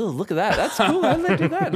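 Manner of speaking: high-pitched, gushing amazement; wowed kid, laughing through it; excited teen reaction. Retold or imitated. Retelling his teenage self marvelling at an ad, excited.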